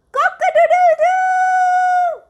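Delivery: high, loud crowing rooster; laughing rooster call. Shown as a demonstration. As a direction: crow like a rooster at dawn. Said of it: "this is the sound of a cock".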